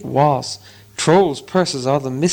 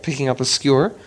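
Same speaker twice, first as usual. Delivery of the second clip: ordinary delivery, nothing marked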